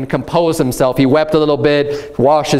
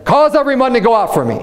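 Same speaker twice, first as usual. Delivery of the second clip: mock crying out; 8 semitones higher, faster than usual. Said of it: "So he cries out".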